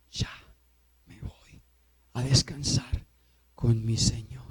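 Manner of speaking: very soft and low, fading away; quietly letting go, as if drifting to rest; hushed resignation. Acted. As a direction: let it sink nearly to a whisper, in short phrases. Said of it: "ahí comprende uno a Pablo diciendo".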